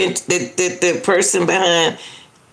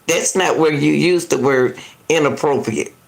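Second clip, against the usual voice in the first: normal talking voice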